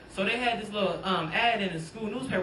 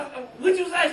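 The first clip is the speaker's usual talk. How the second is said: disbelieving; 8 semitones higher, 5 dB louder, much slower than usual. Retold or imitated.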